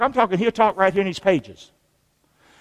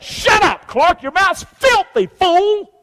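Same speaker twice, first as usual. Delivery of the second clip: 1 voice of God scolding; 10 semitones higher, 4 dB louder, much slower than usual. Retold or imitated. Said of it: "I learned in the Bible, guess what?".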